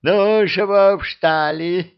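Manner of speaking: high, loud, slow, through a mouthful; mouth full. A narrator's reading. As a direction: mumble it while chewing ham. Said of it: "прошамкал полным ртом".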